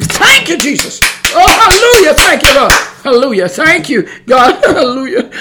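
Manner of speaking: high, loud jubilant cries of thanks, breaking into laughter; ecstatic praise. Real, not acted. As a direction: shout hallelujah in real rapture. Ecstatic spontaneous praise to God.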